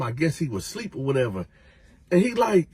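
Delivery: usual speaking voice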